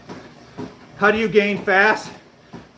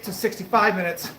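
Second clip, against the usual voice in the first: usual speaking voice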